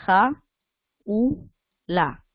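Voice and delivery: "Ja, u, la", a clear pause after each syllable; split into three syllables. Shown as a demonstration. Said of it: "si vas a separarla así".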